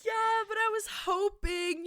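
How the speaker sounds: high, tearful hoping; teary. Real, not acted. Defending her hope to her co-host, teary. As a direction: plead through tears. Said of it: "she is crying".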